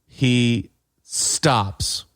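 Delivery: ordinary talk, nothing marked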